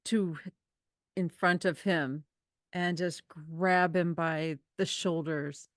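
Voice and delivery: normal talking voice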